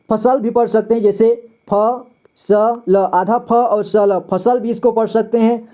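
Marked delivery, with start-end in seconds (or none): 1.7-3.1 "फ, स, ल" sounded out one at a time; letter by letter. Shown as a demonstration. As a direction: say "फ, स, ल" apart, each with its vowel. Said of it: "पूरी तरह से उच्चारण".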